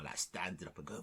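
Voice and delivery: usual speaking voice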